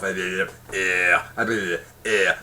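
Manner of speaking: slow, low, drawn-out French "eh, eh, eh"; mock French hesitation. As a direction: parody a French speaker filling pauses. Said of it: "or as French people go".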